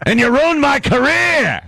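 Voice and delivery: high, slow, shouted mock thanks; yelling Pacino. Pacino thanking the dentist, hammed-up yell. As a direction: bellow it with a wide-open mouth. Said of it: "he went to a good dentist".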